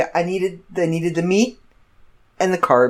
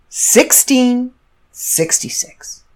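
slow dramatic reveal; 5 semitones higher, much slower than usual. Real, not acted. Telling the listener the ominous total, slow and drawn out. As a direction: draw out each number slowly.

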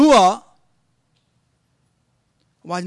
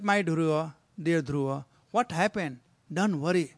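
softer, gently soothing; tender mother; 4 semitones lower, 6 dB quieter than usual. Acted. Mother Suniti comforts her crying son, soft and tender. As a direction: coax him to stop crying, softly.

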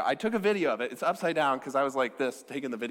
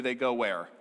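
usual speaking voice

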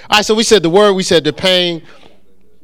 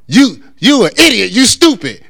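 higher, louder acted insult; mock cussing out; 6 semitones higher, 6 dB louder than usual. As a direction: play someone hurling insults at you. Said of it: "somebody's cussing you out and they say".

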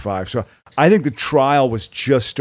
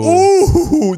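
delighted squeal; 7 dB louder than usual. Real, not acted. Reacting to the Lamb Chop listing.

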